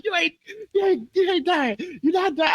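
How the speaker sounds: high imitation of mom protesting; exasperated mother. Voices mom's reply to his panic.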